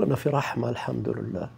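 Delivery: soft inner voice. Shown as a demonstration. Inner voice of aware worshipper.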